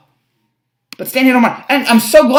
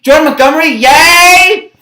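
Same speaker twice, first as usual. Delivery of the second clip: sarcastic yay; 6 semitones higher, 6 dB louder than usual. Real, not acted. Sarcastic cheer for a disliked starter.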